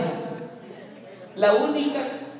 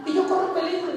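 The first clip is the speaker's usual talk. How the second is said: much higher squeaky mouse pleading; ratoncito desesperado; 12 semitones higher than usual. Acted. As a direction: plead like a tiny frightened mouse. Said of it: "le dijo". (ratoncito suplicando a la vaca, voz muy aguda)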